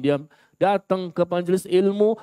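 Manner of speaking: ordinary talk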